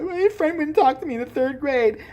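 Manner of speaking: high-pitched mock whine; mock crying complaint. Mocking others crying over childhood stories, high whiny voice. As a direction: make fun of a petty sob story. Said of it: "sitting there crying about".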